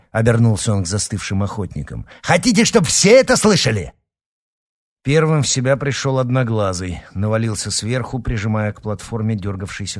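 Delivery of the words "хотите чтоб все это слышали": urgent shout. A narrator's reading. Prim scolds hunters to hold carcass.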